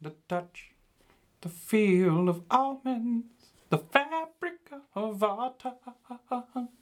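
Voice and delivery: very high, slow, crooning falsetto. Acted. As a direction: croon every word like a soul singer. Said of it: "my name is [name]".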